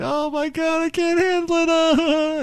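hammed-up wail way up high; dramatic mock freak-out. Shown as a demonstration. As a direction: cry out in exaggerated, squealing despair. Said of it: "if you get really dramatic".

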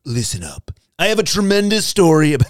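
hammed-up Trump rally voice; rally the folks like Trump; punchy, put-on Trump delivery; Donald Trump impression. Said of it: "best Donald Trump impression".

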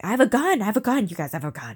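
put-on loud, sloppy drunk yelling; a tipsy woman bragging loudly to her friends; drunken shout. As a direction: wave it around and shout it proudly. Drunk character shouting she has gun.

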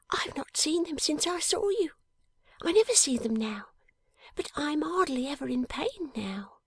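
high, hushed and dreamy; high breathy girl's whisper; tender whispering girl. Acted. Jenny's voice.

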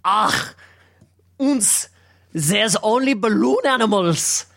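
high-pitched mock German accent. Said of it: "can you do a German accent?".

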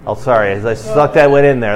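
normal talking voice

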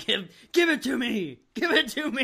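very high, loud whiny plea; hammed-up wrestler begging; Batista voice. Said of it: "He keeps saying".

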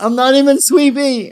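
put-on high whiny toddler voice; whiny baby voice. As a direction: pout it like a cranky toddler. Protesting in baby voice.

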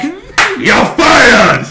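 high, loud shout; shouted firing. Retold or imitated. Boss firing Dreyfus, mock shout. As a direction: yell it like a furious boss.

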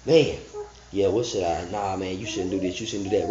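half-swallowed self-talk, talking yourself out of it; muttering to himself. Retold or imitated. Preacher imitating a man counseling himself, soft mutter.

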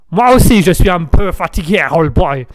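put-on Américain de caricature, accent vacillant; French with a put-on American accent, barely held. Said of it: "J'ai du mal à garder". (accent américain mal tenu)

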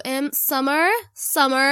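"Summer" in a mock American child's way; child's American-accent name. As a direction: imitate the lad's American "Summer". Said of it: "I can do the way you used to say".

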